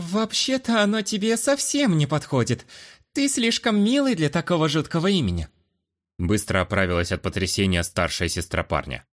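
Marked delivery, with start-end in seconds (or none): 0.1-5.5 put-on high, coquettish girlish voice.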